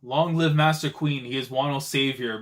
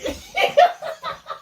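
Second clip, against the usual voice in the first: very high burst of laughter; shrieking laugh; 4 dB louder than usual. Real, not acted. Viewer cracking up at Big Mom, shrieking laugh. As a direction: lose it laughing.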